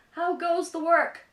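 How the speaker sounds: high, loud calling out from bed; lazy farmer calling. Acted. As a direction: bellow the question up from bed. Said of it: "called the farmer".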